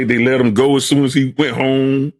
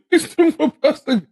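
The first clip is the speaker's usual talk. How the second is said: put-on high, whiny childlike bawling voice, half laughing; mock-sob it like a little kid who lost his bike; imitating crying child; 12 semitones higher than usual. Retelling himself as a boy whose bike was stolen, whiny mock-crying child. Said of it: "I was standing in the corner crying like a baby".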